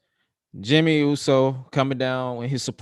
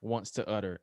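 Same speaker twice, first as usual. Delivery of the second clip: ordinary talk, nothing marked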